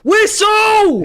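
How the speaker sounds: high, loud shout for the whistle; yelling from the sidelines. Real, not acted. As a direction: yell it at the referee. Fans begging ref to blow.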